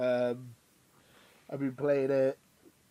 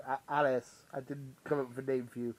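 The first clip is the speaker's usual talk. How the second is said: normal talking voice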